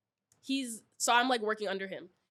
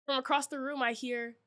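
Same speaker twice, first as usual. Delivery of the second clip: ordinary talk, nothing marked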